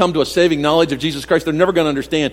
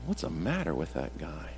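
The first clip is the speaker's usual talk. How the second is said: muttered low, much softer, as a baffled skeptic; scoffing aside; 12 semitones lower, 17 dB quieter than usual. Retold or imitated. A skeptic mocking Paul, muttered much softer. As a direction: shrug it off under your breath like an onlooker. Scoffing reader of Paul's words.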